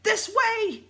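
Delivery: high, loud urgent call. A narrator's reading. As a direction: shout to lead the way. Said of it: "he cried".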